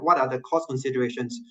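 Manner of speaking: usual speaking voice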